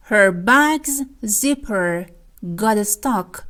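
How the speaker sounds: very slow and spaced; slow example reading. Shown as a demonstration. Teacher dictating the example phrase to students slowly. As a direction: draw out each word for learners.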